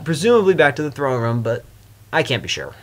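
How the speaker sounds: ordinary talk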